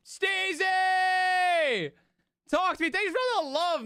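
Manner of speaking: long, high, excited call of a name; hype shout-out. Real, not acted. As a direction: belt the name out in celebration. Shouting out a donor.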